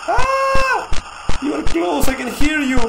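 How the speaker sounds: piercing scream easing into high, loud words; shriek, then call out in alarm; panicked, high and loud; frightened shout. Real, not acted. Scared as pursuer gets close.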